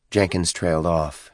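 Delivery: ordinary delivery, nothing marked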